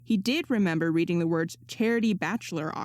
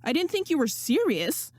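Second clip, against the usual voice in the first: higher, flustered disbelief; spluttering; 7 semitones higher than usual. A narrator's reading. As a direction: splutter it out, caught off guard. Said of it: "Danny spluttered".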